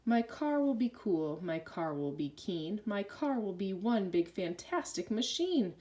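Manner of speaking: ordinary delivery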